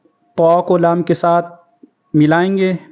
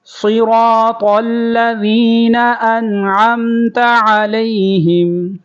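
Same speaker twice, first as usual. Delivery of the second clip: higher, melodic tajweed chant; chanted tilawat, higher; Quran recitation; 4 semitones higher than usual. Shown as a demonstration. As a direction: recite it sung for students to copy. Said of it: "اب میرے ساتھ دہرائیں گے،".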